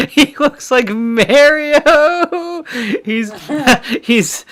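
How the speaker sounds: high squealing laugh through words; laughing hard. Real, not acted. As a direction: crack up while saying it. Laughing at the Mario lookalike.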